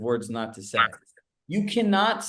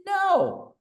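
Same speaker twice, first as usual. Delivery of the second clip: emphatic no; 3 dB louder than usual. Retold or imitated. Answering speaker's question.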